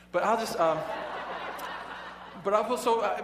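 breaking into laughter mid-sentence; laughing. Real, not acted. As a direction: laugh through the words. Laughs at naming the teacher.

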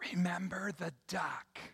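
hushed, menacing stage whisper; conspiratorial whisper. Acted. Sister blackmailing Tommy over the duck, whispered. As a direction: whisper it as a secret reminder. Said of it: "leans over and whispers to Tommy".

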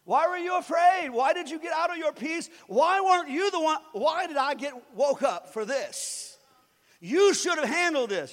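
Jesus rebuking his frightened disciples; scolding Jesus. Acted. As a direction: chide them for their fear. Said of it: "Jesus is the one who said".

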